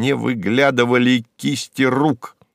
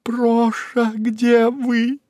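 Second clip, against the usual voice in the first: high, musical, slightly nasal after crying; tender, tearful calling; 10 semitones higher, much slower than usual. Acted. Secretary calling her vanished boss, tender tearful voice. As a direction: call out sweetly in a high singsong. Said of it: "нежным голосом, немного гнусавым после плача".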